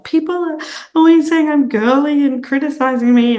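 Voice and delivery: high, tearful little voice, retold; tearful younger self. To her mentor, sobbing about being criticized, high tearful plea. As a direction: replay her own upset plea, a touch whiny. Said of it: "I'm like".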